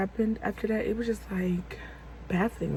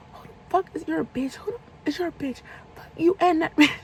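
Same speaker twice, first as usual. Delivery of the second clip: retold outraged demand building to a curse; going off; 8 semitones higher than usual. Storyteller replays going off on the caller, high and furious. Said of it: "I'm just going off on him".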